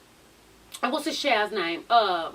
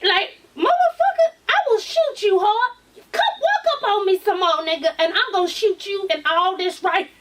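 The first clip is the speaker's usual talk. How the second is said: mock threat shout; 6 semitones higher, 3 dB louder than usual.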